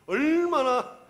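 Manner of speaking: "얼마나" drawn out high and loud; forceful emphasis. Real, not acted. Preacher pictures the demon's screaming for listeners, loud stress on "얼마나". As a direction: hit "얼마나" hard and stretch it.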